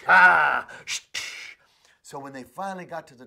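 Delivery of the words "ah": loud urging shout; a driving yell. Acted. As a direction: shout at the horse. Farmer drives horse hard.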